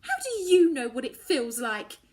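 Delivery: loud demanding anger. A narrator's reading. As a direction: throw the question at her. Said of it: "I demand".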